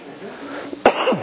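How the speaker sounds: a deliberate muffled cough; vampire cough. Shown as a demonstration. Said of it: "I coughed correctly just then".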